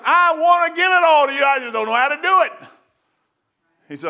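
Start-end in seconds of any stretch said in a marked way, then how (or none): 0.0-2.5 high, loud pleading cry to God; desperate plea. Retold or imitated. Pleading with God, retold prayer.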